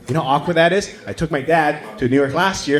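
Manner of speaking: ordinary delivery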